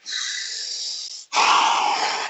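long, breath-only exhale; long exasperated sigh. Retold or imitated. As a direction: let out a drawn-out sigh of exasperation. Exasperated reaction in retold story.

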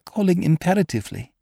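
frightened, compelled woman; urgent dread. A narrator's reading. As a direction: raise the pitch with rising dread. Lady intensifies the repeated word.

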